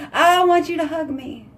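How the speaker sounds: needy whine, imitated. Porcupine wife begging husband for a hug, mock needy. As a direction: plead up high, hammed-up. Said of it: "But I'm like".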